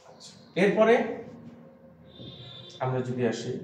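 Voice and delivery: ordinary talk, nothing marked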